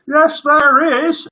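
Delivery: high, loud, slow heckle; joker's shout. Acted. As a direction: shout it cheekily from the back. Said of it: "shouted a joker from the back".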